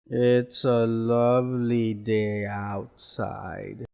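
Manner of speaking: slow, low, downcast demonstration; sad. Shown as a demonstration. Teacher showing students how tone conveys feeling, sad. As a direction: drag it out low and sorrowful. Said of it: "maybe you're sad about it now".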